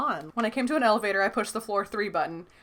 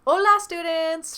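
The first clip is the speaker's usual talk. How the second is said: high, loud, slow cheery hello; joyful greeting; 9 semitones higher, 7 dB louder, slower than usual. A narrator's reading. Spanish teacher greeting her class, high and joyful. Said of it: "she came in joyfully".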